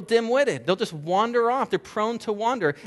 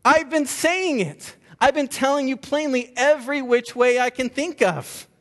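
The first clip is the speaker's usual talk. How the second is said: higher, louder exasperation in Jesus' voice; exasperated Jesus; 8 semitones higher, 4 dB louder than usual. Retold or imitated. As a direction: throw up your hands as a frustrated Jesus. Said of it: "Jesus would be like".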